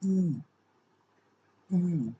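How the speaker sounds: two separate high, rounded ऊ sounds; German U-umlaut sound. Shown as a demonstration. Teacher answering what U-umlaut sounds like, high long ऊ. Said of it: "ऊमलाउट की क्या sound है".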